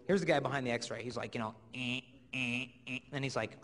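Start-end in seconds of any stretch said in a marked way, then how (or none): 1.7-3.0 low, soft dumbfounded grunts; the puzzled security guy staring at the screen; x-ray guard. Retold or imitated. Imitating the airport x-ray guard, low dumbfounded grunts.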